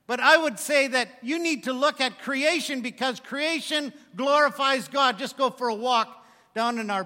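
loud, high, driving sermon voice; preaching at full force. Acted. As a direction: preach it out to the congregation. Excited preaching on creation.

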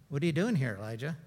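low, soft divine question; quiet, gentle God voice. Acted. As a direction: ask it hushed and kind. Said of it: "he just said that".

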